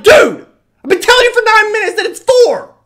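high, full-out shout; loud, high-pitched outburst of frustration; exasperated yell. Acted. Acts exploding at pupil.

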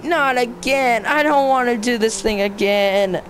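ordinary delivery

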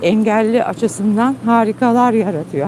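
ordinary talk, nothing marked

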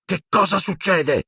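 lightly voiced Seamus, startled; startled exclamation. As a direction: call out half-asleep. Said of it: "esclamò".

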